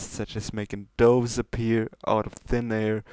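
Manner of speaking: usual speaking voice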